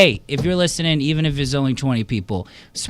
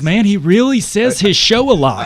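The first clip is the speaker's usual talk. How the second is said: higher, louder mocking quote; mock listener voice; 7 semitones higher, 6 dB louder than usual. Comedian mocking a fan's reaction, lightly voiced. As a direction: poke fun at yourself through a fan's voice.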